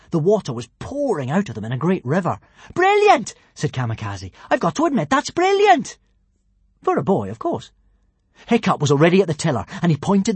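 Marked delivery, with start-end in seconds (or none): 2.7-3.4 high, loud burst of delight; excited exclamation. Acted. 4.5-7.7 excited praise. Acted. Kamikaze grudging praise.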